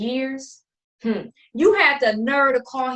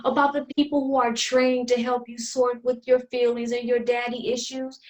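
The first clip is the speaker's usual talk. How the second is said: ordinary delivery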